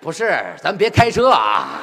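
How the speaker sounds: slow, loud half-joking shout. Acted. Mock-shouting over the dialect joke.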